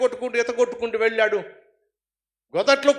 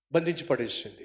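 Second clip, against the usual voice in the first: ordinary talk, nothing marked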